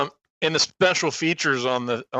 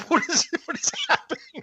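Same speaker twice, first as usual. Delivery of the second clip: high-pitched imitation of bewildered panic; mock freaking out; 13 semitones higher than usual. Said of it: "he's freaking out".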